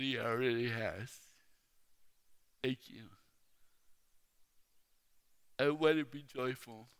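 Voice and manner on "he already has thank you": low, soft, heartfelt answer; quiet sincere reply. Retold or imitated. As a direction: lower your voice and answer gently. Retells own sincere quiet answer.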